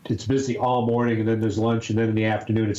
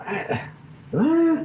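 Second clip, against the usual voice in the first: high innocent voice. Retold or imitated. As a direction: yelp it up high. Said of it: "the response would be".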